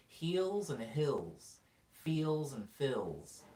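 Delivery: very slow careful heels, hills, feels, fills; E and I contrast. Shown as a demonstration. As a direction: draw out each vowel to keep "Heels" and "hills" apart. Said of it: "I have a really hard time with E and I".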